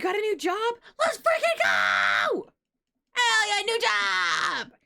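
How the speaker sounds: loud, high, slow cheering; excited shout. Real, not acted. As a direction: yell it out in celebration. Cheering a viewer's new job.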